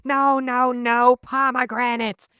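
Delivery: high, drawn-out mock scream; imitating screaming teacher. Imitating teacher from a video, mock screaming. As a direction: screech like an overwhelmed teacher in a comic impression. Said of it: "that one teacher screaming like".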